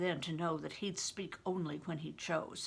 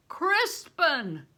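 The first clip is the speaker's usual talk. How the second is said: loud, high call of a name; cry out; 8 semitones higher, 8 dB louder than usual. A narrator's reading. Bear calling out to Crispin, loud cry. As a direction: shout his name across the camp. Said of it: "I heard Bear cry out".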